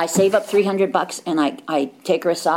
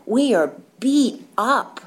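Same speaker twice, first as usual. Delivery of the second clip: slow, higher, weighted "We are beat up"; 1 slow emphatic repeat; 6 semitones higher, 4 dB louder, slower than usual. Real, not acted. Speaker to the meeting, stressing "We are beat up" slowly. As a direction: lean on each word of "We are beat up".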